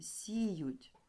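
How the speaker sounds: "сіють" stretched on the first vowel; drawn-out example word. Shown as a demonstration. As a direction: sound out "сіють" slowly, lengthening сі. Teacher sounds out example word.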